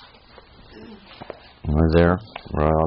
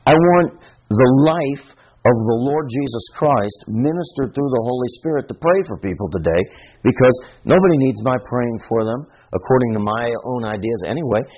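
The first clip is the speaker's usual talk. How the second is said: normal talking voice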